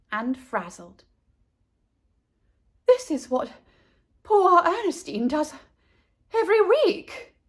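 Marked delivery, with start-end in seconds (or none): 2.9-7.4 panting between words; breathless after running; out of breath. Acted. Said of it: "Missus Buckmeister panted".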